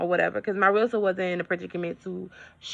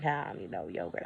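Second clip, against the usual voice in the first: dismissive; 12 semitones lower, 12 dB quieter, faster than usual. Retold or imitated. Dismissing yogurt to herself.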